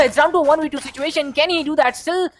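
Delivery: rising, loud, hyped-up call; excited commentary. Real, not acted. Caster hyping a clutch.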